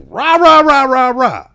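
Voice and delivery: loud, high mock rant; aggressive ranting. Mimicking the other driver getting aggressive, loud mock ranting. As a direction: imitate an angry man's rant as pure noise. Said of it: "back to him getting aggressive".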